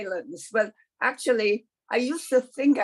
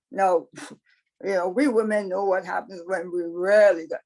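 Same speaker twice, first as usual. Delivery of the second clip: plain reading voice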